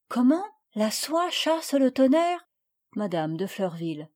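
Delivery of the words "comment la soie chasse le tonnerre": amazed at the idea; surprised child. A narrator's reading. Marguerite asks her mother about silk, surprised child voice.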